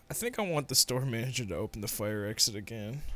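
low, soft mock-villain voice; smug fire marshal. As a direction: play the marshal savoring a petty power trip. Acted marshal answering his partner.

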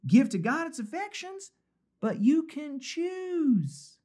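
put-on high, lilting female Southern accent; reassuring Southern belle. Preacher reads the author's counsel as a reassuring Southern belle. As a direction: read it as a high-voiced Southern lady. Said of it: "Southern belle saying".

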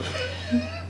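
a high-pitched burst of laughter, quiet; cracking up at his own joke. Real, not acted. Laughing at his own nickname for the quarterback, high and soft.

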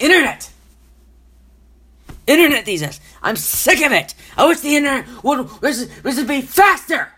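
loud, high-pitched angry tirade; furious rant. Real, not acted. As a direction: blow up in exasperation at the useless internet. Furious rant about slow internet.

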